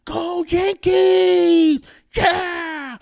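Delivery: very high, long-held mock scream; screaming fan imitation. Imitating a weirdo fan for the camera, screaming. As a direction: drag out each word like a screaming fan. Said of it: "you know, the guy screaming".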